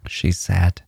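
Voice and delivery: plain reading voice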